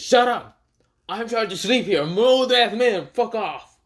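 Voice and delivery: high, loud, fed-up outburst; angry yell. Acted. Old Jubi telling off the noisy Momoshiki, angry yell. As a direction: snap like a sleeper woken by noise.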